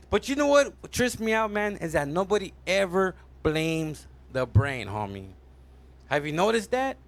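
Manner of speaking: ordinary delivery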